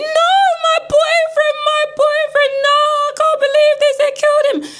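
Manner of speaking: very high, loud drawn-out wail; over-the-top imitation of a screaming woman; mock screaming. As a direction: wail hysterically as the mum, hammed up. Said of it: "The mum is there screaming".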